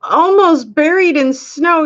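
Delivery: ordinary talk, nothing marked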